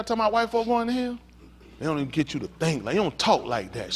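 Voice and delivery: usual speaking voice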